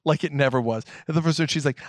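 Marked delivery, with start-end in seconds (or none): none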